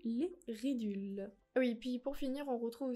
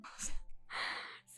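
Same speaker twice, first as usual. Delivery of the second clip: breathy laugh. Real, not acted. After her shocked outburst.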